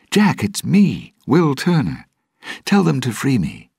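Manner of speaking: high, urgent call for recognition; urgent plea. Acted. Captive Will begs Jack to recognise and free him, urgent plea.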